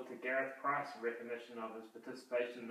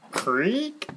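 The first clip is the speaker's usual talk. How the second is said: door creak; 10 semitones higher, 13 dB louder than usual. Pretend.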